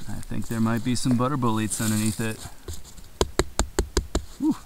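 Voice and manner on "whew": usual speaking voice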